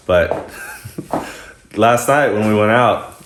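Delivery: ordinary talk, nothing marked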